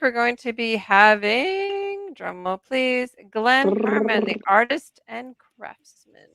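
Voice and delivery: drawn-out, suspenseful guest announcement; announcer reveal. Real, not acted. Host announces next week's guest, drawn-out announcer build-up. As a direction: stretch it out like a big reveal.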